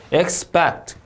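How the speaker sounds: "expect" stressed on PECT; second-syllable stress. Shown as a demonstration. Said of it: "이음절 강세". ("expect"의 둘째 음절에 강세)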